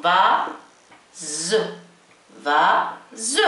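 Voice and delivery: teacher's model of "فاز", long gap before the buzzing final syllable; French "vase" pulled apart into two syllables. Shown as a demonstration. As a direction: split "فاز" slowly into "va... ze" with a voiced Z. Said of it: "هنا دائمًا زاد".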